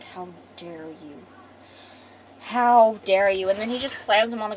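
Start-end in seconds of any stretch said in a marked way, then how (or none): 0.0-1.2 low, slow, hushed menace, acted; quiet outrage. Callum to the killer, low slow quiet outrage. Retold grieving man confronts the killer. 2.4-3.5 loud, slow, drawn-out challenge, acted; mounting outrage. Callum about to slam the killer, loud slow outrage.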